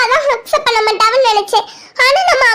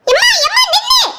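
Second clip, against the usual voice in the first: calling after mother; 8 semitones higher than usual. Acted. Daughter calls after leaving mother.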